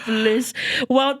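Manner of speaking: usual speaking voice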